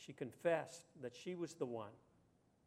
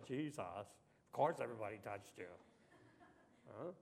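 lower, fast, exasperated retort; eye-rolling; 7 semitones lower, 5 dB quieter than usual. Retold or imitated. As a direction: roll your eyes and toss it off. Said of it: "Peter probably rolled his eyes and said".

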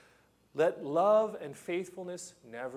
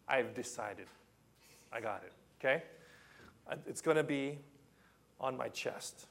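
ordinary talk, nothing marked

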